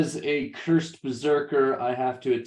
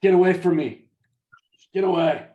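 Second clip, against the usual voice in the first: angry; 4 semitones higher, 6 dB louder than usual. Acted. Cursed berserker fending off ally.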